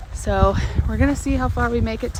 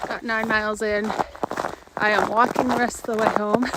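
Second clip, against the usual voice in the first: normal talking voice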